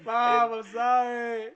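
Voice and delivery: loud, high, drawn-out fake cry; mock wailing. Co-host mocking the phone call home, fake wail. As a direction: wail it like a sobbing kid, hammed up.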